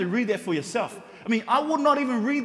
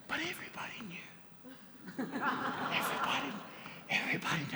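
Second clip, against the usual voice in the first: breathy stage whisper, much softer; 4 semitones higher, 13 dB quieter than usual. Acted. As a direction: whisper it like a juicy secret. Scandal hinted in hushed aside.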